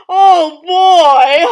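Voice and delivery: very loud groaning outburst; exasperated shout. Acted. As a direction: explode in exasperation. Stepmother exasperated.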